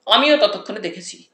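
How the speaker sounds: plain reading voice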